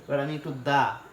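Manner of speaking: normal talking voice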